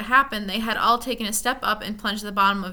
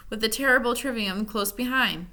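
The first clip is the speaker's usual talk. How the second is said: ordinary delivery, nothing marked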